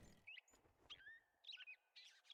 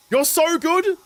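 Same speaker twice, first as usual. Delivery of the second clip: playful high teasing voice; 8 semitones higher, slower than usual. Pretend. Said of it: "I am so good".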